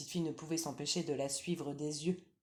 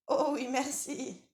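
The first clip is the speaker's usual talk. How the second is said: lightly higher, louder granny voice; old grandmother; 9 semitones higher, 4 dB louder, slower than usual. Acted. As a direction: call out as a drowsy old granny from bed.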